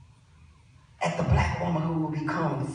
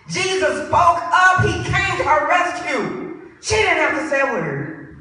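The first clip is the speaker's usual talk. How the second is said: high, loud triumphant proclamation; full-force preaching; 10 semitones higher, 8 dB louder than usual. Real, not acted. Preacher declares Jesus came to her rescue, triumphant preaching. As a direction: ring it out to the congregation.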